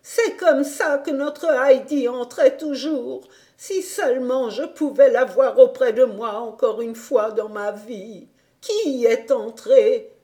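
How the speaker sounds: a hint of an old woman, longing; lightly voiced grandmother.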